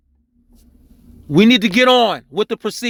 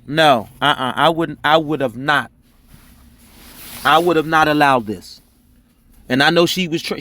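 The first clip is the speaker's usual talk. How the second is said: normal talking voice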